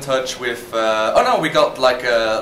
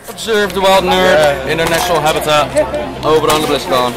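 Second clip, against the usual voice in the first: nature documentary; 9 dB louder than usual. Pretend. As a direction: narrate the nerds like wildlife. Mock wildlife narration at convention.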